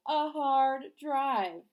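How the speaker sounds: slow, loud laughing repeat of the punchline. Acted. Danny laughs at his own joke to Beth. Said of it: "Danny laughed".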